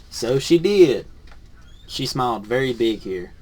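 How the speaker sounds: lively picture-book read-aloud; reading to children. Acted. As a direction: read it to a class of kids.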